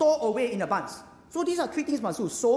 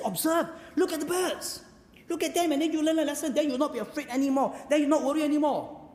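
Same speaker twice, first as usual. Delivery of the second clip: Jesus urging; 7 semitones higher than usual. Acted. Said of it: "So Jesus says".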